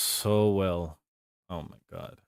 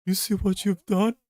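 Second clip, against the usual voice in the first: much higher and louder scolding squeal; high, loud mock reproach; 12 semitones higher, 8 dB louder than usual. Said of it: "the tone of voice is like".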